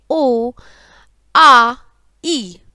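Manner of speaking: ओ, आ, ई each held long, with pauses between; prolonged vowels. Shown as a demonstration. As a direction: stretch ओ, आ, ई one at a time, आ strong and loud. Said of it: "ह्रस्व स्वर से तीन गुना अधिक समय लगाकर". (ओ, आ, ई का खिंचा हुआ प्लुत उच्चारण)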